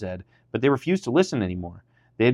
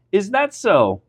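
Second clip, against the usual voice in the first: high, louder incredulous challenge; sharp retort; 10 semitones higher, 4 dB louder, slower than usual. A narrator's reading. Pharaoh answers Moses and Aaron's demand, sharp retort. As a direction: retort like a scornful ruler. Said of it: "retorted Pharaoh".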